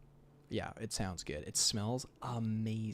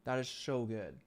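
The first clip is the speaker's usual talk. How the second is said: chewing while talking; 3 dB quieter than usual. Real, not acted. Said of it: "Sorry, I'm chewing on stream".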